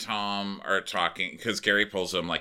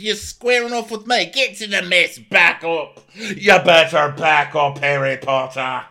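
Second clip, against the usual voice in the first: high, very loud put-on yelling; theatrical confrontational shout; hammed-up furious order; 10 semitones higher, 9 dB louder than usual. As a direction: imitate someone bellowing him back. Acting Daisy's furious standoff.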